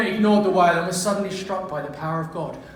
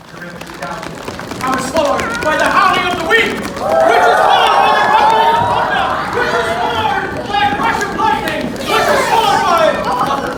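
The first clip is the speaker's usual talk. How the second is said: louder and higher with each clause; building storm; 14 semitones higher, 11 dB louder than usual. Acted. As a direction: build it to a roar.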